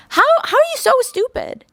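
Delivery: high-pitched disbelieving cry of frustration; exasperated outburst. Real, not acted. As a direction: blurt it out, fed up and incredulous. Exasperated rant at the character.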